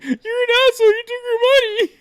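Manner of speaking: hammed-up high boy voice; squeaky kid voice. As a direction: mimic a preteen scolding his friend. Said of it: "just our voices then".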